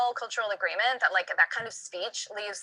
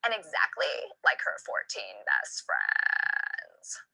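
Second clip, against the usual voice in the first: vocal fry; 4 dB quieter, slower than usual. Shown as a demonstration. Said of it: "sounds world weary".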